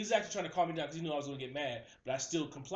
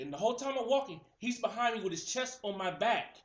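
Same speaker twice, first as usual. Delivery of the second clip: ordinary talk, nothing marked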